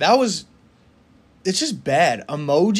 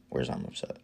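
soft whispered mutter while scanning a list; whispered to self; 13 semitones lower, 13 dB quieter, faster than usual. Real, not acted. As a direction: breathe it under your breath, searching.

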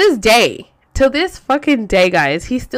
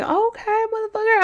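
much higher mock-sweet challenge; retold anger; 13 semitones higher than usual. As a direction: pitch it way up, defiantly. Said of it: "like, hella mad".

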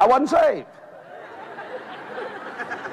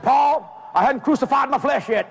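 raised-pitch challenge; defiant mama; 6 semitones higher than usual. Retold or imitated. Mama to daddy over the gun, defiant. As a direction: dare him in a higher voice.

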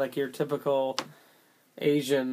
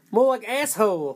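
mocking jab; 5 semitones higher, 4 dB louder than usual. Real, not acted. Mocking the film's title.

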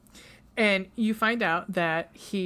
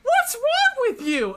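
high, loud, slow hammed-up yell; mock a bad actress screaming the line; imitating a bad actress shouting, much higher; overacted scream; 6 dB louder, slower than usual. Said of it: "This is her acting".

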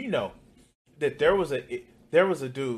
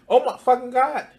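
higher and louder oh my god; mock outrage; 9 semitones higher, 6 dB louder than usual. Reacting to a three percent tax, hammed-up loud mock shock. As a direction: push the fake shock over the top.

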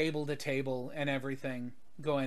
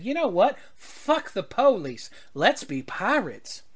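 put-on rebellious girl quote; 6 semitones higher, 4 dB louder than usual. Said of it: "when she said".

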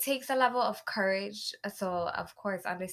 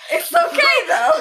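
laughing; 7 semitones higher, 13 dB louder than usual. Real, not acted. Laughing over her celebrity husband joke.

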